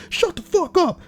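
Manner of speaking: high put-on delivery; mock high-pitched retort; imitating the retort. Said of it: "a very well-timed, uh, flawlessly executed".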